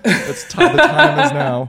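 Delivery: slow, high, laughing proclamation; grand declaration. Pretend. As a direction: announce it like a prophecy. Mock grand declaration to guest.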